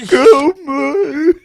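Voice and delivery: loud, tormented movie-monster plea; Elephant Man. Acted. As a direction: wail it out in mock agony. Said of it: "have you seen the Elephant Man film?".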